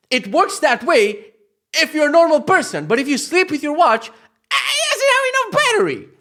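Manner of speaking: exasperated laugh-rant. Real, not acted. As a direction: crack up while ranting. Laughing rant about watch.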